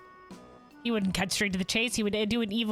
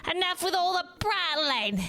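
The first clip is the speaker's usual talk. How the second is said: indignant shout; 10 semitones higher than usual. Acted. Ally character shouts.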